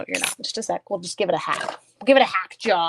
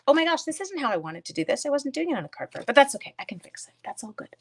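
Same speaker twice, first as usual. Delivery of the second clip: usual speaking voice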